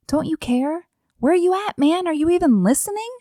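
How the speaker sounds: high, incredulous complaint; mock-exasperated prayer, rushing at the end; exasperated plea. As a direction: demand an answer, pitch jumping up. Acts out her own exasperated prayer.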